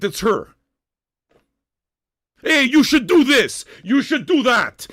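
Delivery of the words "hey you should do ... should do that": loud, slow mimicry of a nag; mock their orders with heavy punch; mocking bossy commenter. Host mimicking viewers who give advice, mocking shout.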